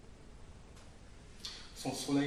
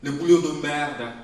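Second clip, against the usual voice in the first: bitter outburst; 10 dB louder than usual. Acted. Listing mother's hardships.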